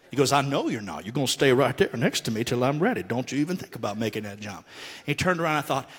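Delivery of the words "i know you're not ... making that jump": low, lightly voiced stern father; stern dad. Father keeping his son beside him, low and stern. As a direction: lay down the law like a dad.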